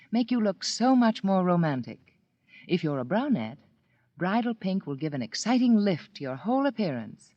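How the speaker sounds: normal talking voice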